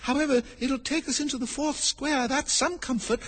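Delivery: put-on high-pitched girl's voice; little Alice musing aloud; Alice talking to herself. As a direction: reassure yourself in a high voice. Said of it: "she said to herself".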